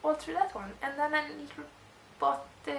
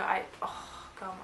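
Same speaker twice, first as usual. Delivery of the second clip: ordinary delivery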